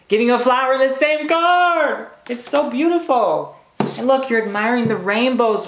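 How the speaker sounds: high, loud delighted burst; thrilled squeal of wonder; excited gush. Real, not acted. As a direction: gush over the card with real delight. Gushing over the tarot card.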